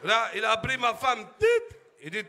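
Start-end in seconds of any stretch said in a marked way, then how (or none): 1.4-1.8 high beep. Retold or imitated. Thermometer beep imitated.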